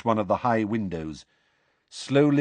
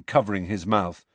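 plain reading voice

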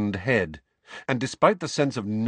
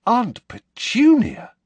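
much higher, loud, slow disbelief; astonished; 9 dB louder, slower than usual. A narrator's reading. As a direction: gasp out the name. Harry reeling, astonished.

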